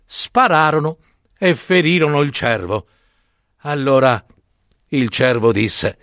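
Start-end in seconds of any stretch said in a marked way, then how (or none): none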